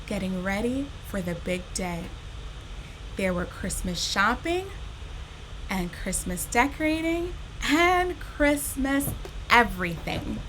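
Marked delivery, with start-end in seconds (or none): none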